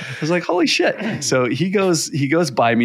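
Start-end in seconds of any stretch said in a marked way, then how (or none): 0.4-1.2 high-pitched astonished exclaim; retold shock, pitched way up; amazed. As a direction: gasp it out in disbelief. Reacting to near crash.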